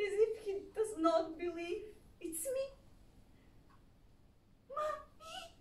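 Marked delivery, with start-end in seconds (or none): 4.7-5.5 high and very soft, disbelieving; child whispering in disbelief; soft wondering Mommy. Retold or imitated. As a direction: breathe it as if unsure.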